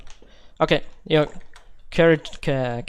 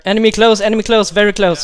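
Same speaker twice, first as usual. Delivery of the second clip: urgent high alarm yell; shouted warning; 6 semitones higher, 9 dB louder than usual. Acted. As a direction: warn the squad at full voice. Enemy spotted in firefight.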